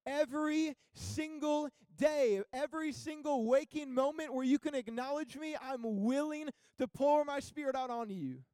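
loud, high preaching with drawn-out key words; impassioned preaching. Acted. Preacher urging the church that they need Him, impassioned. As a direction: preach it with full force and weighty pauses.

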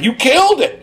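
high, loud enthusiastic praise; excited praise. Retold or imitated. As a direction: cheer it up high. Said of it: "he was like".

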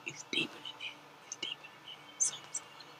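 hushed unvoiced whisper. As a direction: whisper like sensing something hidden. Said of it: "I was like".

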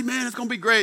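high, eager whoop of anticipation; hyped-up buddy. Acted. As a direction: pump up the guys. Buddies acted getting hyped for club.

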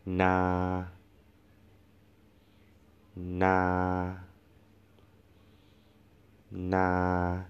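na held level and even, no rise or fall; Thai mid tone. Shown as a demonstration. Teacher shows students the mid tone, flat na. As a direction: say na flat all the way through. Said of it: "flat tone".

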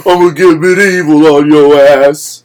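slow, loud tough-guy menace; Ving Rhames impression. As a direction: loom over him, every word a promise. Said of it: "He's like".

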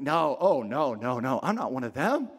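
low, dodging denial; evasive denial. Acted. Peter to his questioner, low and evasive. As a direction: brush off the accusation in a dropped voice. Peter denies knowing Jesus when asked.

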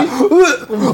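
high gag with laughter; mock gagging laugh. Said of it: "sentí el aroma". (arcada fingida con risa)

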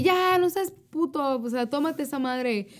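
mock yelling at a friend to drink up; retold drunk yell. Retelling yelling at religious friend to drink. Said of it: "yo le empecé a gritar".

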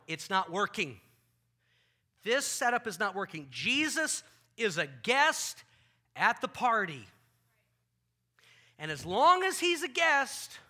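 loud, slowing frustrated outburst. Retold or imitated. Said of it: "Mary is frustrated".

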